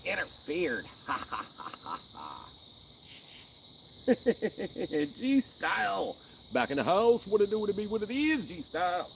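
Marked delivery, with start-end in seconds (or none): 5.2-9.1 catchphrase intro. Acted. Signature greeting, hyped.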